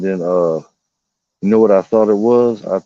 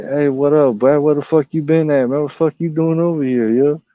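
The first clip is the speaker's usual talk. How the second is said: higher, friendly owl greeting; an owl buddy calling out, higher; voicing the owl; 6 semitones higher than usual. Owl relative greeting the speaker, higher and lively.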